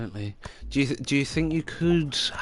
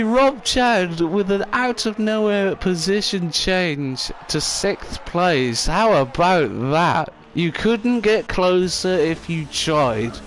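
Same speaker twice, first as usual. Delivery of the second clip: high, loud and drawn out; thrilled commentary; 5 semitones higher, 6 dB louder, slower than usual. Real, not acted. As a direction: savour each word of the finish. Commentator hails a last-gasp move.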